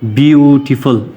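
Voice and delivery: Beautiful stretched slowly across its syllables; syllable demo. Shown as a demonstration. Teacher joining three syllables for learners, beautiful slow and drawn out. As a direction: draw out beautiful, every syllable clear. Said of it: "इसको collect कर ले".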